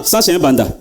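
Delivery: ordinary talk, nothing marked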